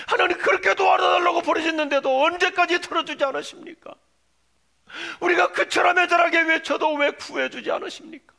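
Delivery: sullen complaint cried out loud and high; Habakkuk. Acted. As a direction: demand an answer from God in a pleading shout. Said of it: "볼멘 소리로 하나님을 향하여 질문하면서". (볼멘소리로 크게 부르짖으며)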